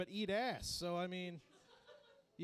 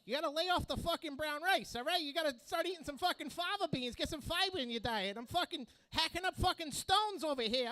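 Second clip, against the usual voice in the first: higher, brash fast-talking Pesci voice; Joe Pesci impression; 6 semitones higher than usual. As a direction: do a hyped-up little New York tough guy. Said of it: "That's Joe Pesci, all right?".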